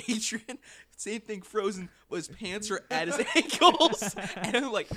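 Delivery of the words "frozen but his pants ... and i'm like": ordinary delivery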